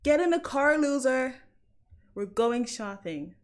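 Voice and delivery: movie-quote American accent; attempted American accent. Shown as a demonstration. Londoner quoting Mean Girls for viewers, attempted American accent. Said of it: "I can't do an American accent".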